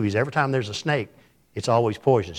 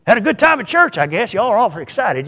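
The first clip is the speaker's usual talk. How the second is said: teasing dad; 8 semitones higher, 4 dB louder than usual. Retold or imitated. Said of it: "he's just going on kidding around".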